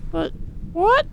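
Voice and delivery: put-on high, thin old woman questioning; frail wife baffled by the order; confused old Molly. Wife questions husband's order, high and confused.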